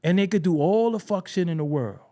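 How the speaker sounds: ordinary delivery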